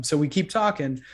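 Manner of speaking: ordinary delivery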